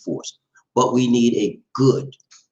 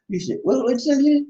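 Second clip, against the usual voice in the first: ordinary talk, nothing marked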